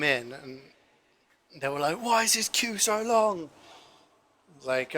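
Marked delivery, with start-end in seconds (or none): 2.0-3.5 high, drawn-out mock complaint; annoyed builders. Builders coming in for lunch, hammed-up annoyance. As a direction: imitate moaning customers, slow and much higher.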